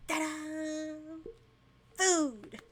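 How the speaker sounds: loud bright ta-da; excited announcement. Acted. Host presents breakfast to guests, loud and excited. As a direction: present the food like a showman.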